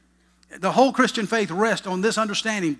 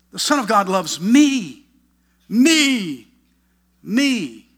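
slow build, each "me" stretched out alone; loud, drawn-out "me", repeated after pauses; emphatic "me"; 3 semitones higher, 5 dB louder, much slower than usual. Real, not acted. Preacher pressing Paul's claim on congregation, emphatic.

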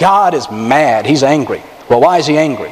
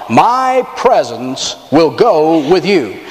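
drawn out, every word weighed; slow solemn reading; much slower than usual. Acted. Preacher slowly reading God's promise to the congregation, solemn.

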